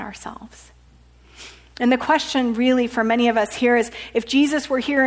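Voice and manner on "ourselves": whisper. Acted. Sermon, phrase trails into hush.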